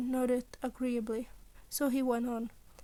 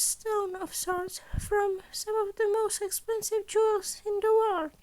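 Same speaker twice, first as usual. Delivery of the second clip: high, louder principal laying out the heist; Nezu voice; 8 semitones higher, 4 dB louder than usual. Acted. Principal briefing students on the gala heist, high and bright. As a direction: pitch it up like a cheerful small headmaster.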